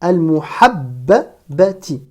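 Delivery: "Al-muhabbabati" slowly split after the doubled b; slow shadda demo on "Al-muhabbabati". Shown as a demonstration. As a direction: hold the b, then separate the syllables in "Al-muhabbabati". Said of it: "un premier ba ici qui porte une shadda".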